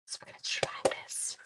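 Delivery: whispered while concentrating. Real, not acted. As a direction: hush it out as you focus on the stamp. Concentrating on stamping.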